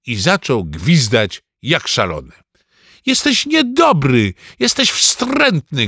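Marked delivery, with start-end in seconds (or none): 3.1-5.9 put-on high, drawn-out childish accusation; tearful cry. A narrator's reading. Ananiasz accusing Rufus before bursting into tears, upset cry. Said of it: "krzyknął Ananiasz i się rozpłakał".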